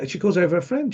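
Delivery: ordinary delivery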